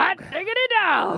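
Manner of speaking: hammy cheer. Acted. Hammed-up cheer back.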